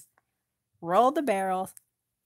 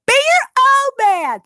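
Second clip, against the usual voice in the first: high, loud urging shout; rally him loudly; rousing shout; 14 dB louder than usual. Acted. Hilda urging Alfred.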